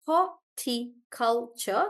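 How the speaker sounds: horticulture slowly split into four beats; syllable by syllable. Shown as a demonstration. Teacher sounding it out for Rohan, slow syllabled horticulture. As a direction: space out each syllable of horticulture.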